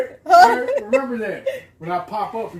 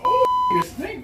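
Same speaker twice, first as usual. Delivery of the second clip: disgusted shriek. Real, not acted.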